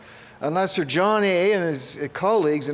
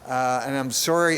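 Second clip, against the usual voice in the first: ordinary talk, nothing marked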